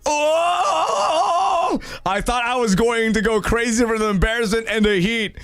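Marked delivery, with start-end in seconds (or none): 0.0-1.8 retold scream. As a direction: yelp it out as if scalded. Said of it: "I screamed".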